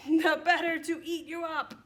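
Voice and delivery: loud, high big bad wolf for children; hammed-up wolf going in for the bite; pouncing wolf. Wolf line repeated with force.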